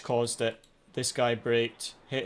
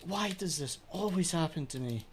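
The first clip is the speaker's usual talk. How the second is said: real despairing shouts, voice cracking high; anguished outcry; 9 semitones higher, slower than usual. Real, not acted. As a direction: cry out at the broken wheel. Wheel breaks mid-race, despairing outburst.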